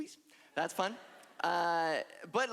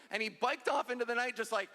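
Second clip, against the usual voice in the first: ordinary talk, nothing marked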